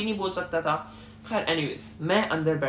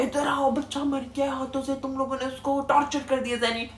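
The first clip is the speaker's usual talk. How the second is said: mother crying out; 7 semitones higher than usual. Retold or imitated. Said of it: "जोर जोर से चीख रही है".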